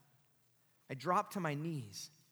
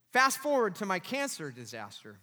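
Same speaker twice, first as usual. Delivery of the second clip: normal talking voice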